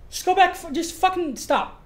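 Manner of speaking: high and loud, then slowing down; flustered outburst. Real, not acted. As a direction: snap at the crew in frustration. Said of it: "I'm flustered".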